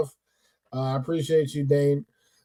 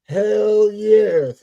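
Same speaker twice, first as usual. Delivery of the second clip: quoting a catchphrase; 4 semitones higher than usual. Retold or imitated. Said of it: "as, uh, somebody would say".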